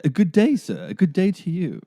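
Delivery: doff your hat like a stiff English gentleman; hammed-up upper-class English accent; posh English greeting. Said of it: "What are the English greetings then?".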